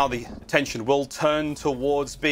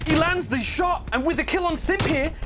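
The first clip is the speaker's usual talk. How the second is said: esports caster; 13 semitones higher than usual. Real, not acted. Caster on replay of play.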